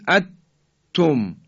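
«اتم» with the noon swallowed into a doubled ت; idgham. Shown as a demonstration. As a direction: drop the n and stop hard on the t of «اتم». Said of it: "اینم که دیگه حالت ادغامه".

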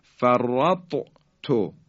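فرط with a released heavy ط, then تو apart; two separate sounds, heavy ط and light ت; letters sounded separately. Shown as a demonstration. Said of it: "ته دسته داره ساکنه".

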